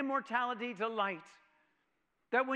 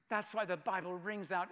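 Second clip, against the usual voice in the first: ordinary delivery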